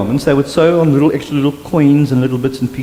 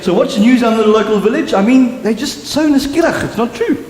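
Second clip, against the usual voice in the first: eager gossip question; 7 semitones higher than usual. Retold or imitated. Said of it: "She'll phone her mother and say".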